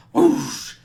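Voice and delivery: loud rushing whoosh noise; imitated whoosh. Imitating Thor's lightning hitting the man, loud whoosh. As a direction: make a big lightning whoosh with the mouth.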